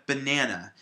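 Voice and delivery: "banana" with the middle syllable stressed. Shown as a demonstration. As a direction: say "banana" the standard way, leaning on NAN. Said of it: "we put the stress on the middle syllable".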